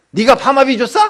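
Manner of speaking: indignant shout of "who paid for your perm"; angry retort. Acted. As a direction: bite back at the critic, loud.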